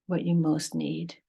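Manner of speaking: measured meditation voice with long pauses; guided meditation. Acted. Teacher leading listeners to breath and heart, guided meditation. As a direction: guide them unhurriedly, phrase by phrase, leaving space between.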